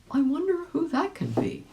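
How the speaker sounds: high, wondering; curious. A narrator's reading. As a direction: wonder aloud, puzzled. Rabbit wonders aloud.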